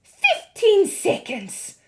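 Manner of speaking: very high, loud witch cry. A narrator's reading. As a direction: shriek the countdown gleefully. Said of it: "cried the Grand High Witch".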